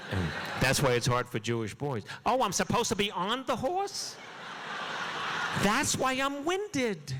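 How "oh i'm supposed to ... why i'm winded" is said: put-on clueless city boy. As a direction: play the dazed rider who just realized the horse exists.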